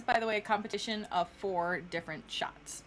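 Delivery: normal talking voice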